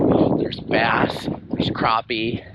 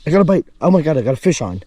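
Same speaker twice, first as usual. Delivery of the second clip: excited; 4 semitones higher, much faster than usual. Real, not acted. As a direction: yelp with surprise at the bite. Sudden bite while fishing.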